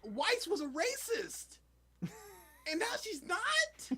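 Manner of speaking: high, slow, drawn-out disbelief; incredulous exasperation. Real, not acted. Fan objecting to a changed character, slow and incredulous. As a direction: stretch each point in high-pitched outrage. Ranting about inconsistent characters.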